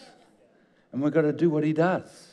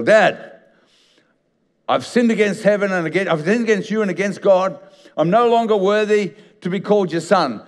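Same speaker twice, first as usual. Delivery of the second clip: pleading son, pitched higher and louder; a retold son begging his father, raised and urgent; son's desperate confession; 6 semitones higher, 6 dB louder than usual. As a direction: blurt the apology out to Dad.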